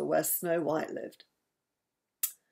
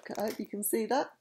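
usual speaking voice

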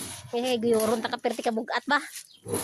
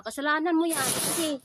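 usual speaking voice

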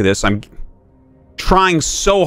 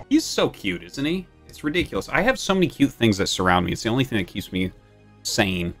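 talking to a puppy; 5 semitones lower, 8 dB quieter than usual. Real, not acted. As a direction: hush and coo over the dog. Cooing softly to puppy.

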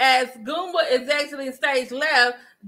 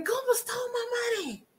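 higher and a mock tearful cry; mock crying wail; 11 semitones higher, 7 dB quieter than usual. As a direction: wail it like a fake sob. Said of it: "She's telling everybody".

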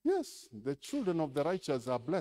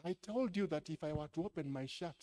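ordinary delivery

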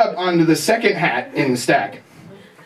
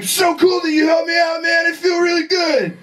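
higher, shouted over-the-top thanks; excited shout; 11 semitones higher than usual. Retold or imitated. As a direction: bellow the gratitude with intensity. Hippie shouting his gratitude.

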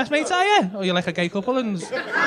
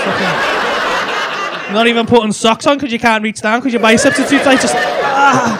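laughing through it, louder and louder; cracking up mid-sentence; 8 dB louder, slower than usual. Real, not acted. Mocking the gym guy while laughing loudly.

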